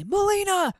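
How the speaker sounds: calling out a name, high and loud; sudden call for help; shouted name. Retold or imitated. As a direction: yell the name across the room. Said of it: "like, super loud".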